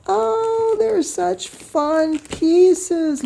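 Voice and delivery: high, drawn-out coo of delight; delighted gushing. Real, not acted. Quilter admiring gifted fabric pieces, high delighted gushing. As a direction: gush slowly, way up high.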